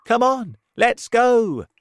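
high, loud rallying call; eager urging. A narrator's reading. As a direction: urge everyone on excitedly. Group cries out, eager to leave.

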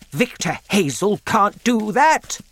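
high, loud furious outburst; angry shout. A narrator's reading. Doc Spencer shouting angrily about the landowner's pits. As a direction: rage at Victor Hazel. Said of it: "shouted Doc Spencer".

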